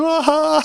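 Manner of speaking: a high, loud crying wail; overwhelmed wail at the puja; weeping wail. Retold or imitated. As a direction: let out a sobbing cry. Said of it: "I'm still weeping".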